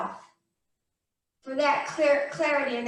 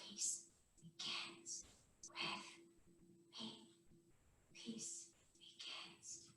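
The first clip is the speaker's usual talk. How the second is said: whisper; 5 semitones lower, 29 dB quieter than usual. Shown as a demonstration. Teacher guiding kids through a quiet meditation, whispered. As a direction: whisper each word on its own, spaced out. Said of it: "Two more whispers".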